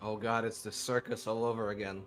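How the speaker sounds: usual speaking voice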